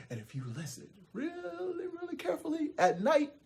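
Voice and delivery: soft, high, stretched "really"; hushed storytelling. A narrator's reading. Narrator tells listeners to listen carefully at night, hushed. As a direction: draw listeners in with a hush.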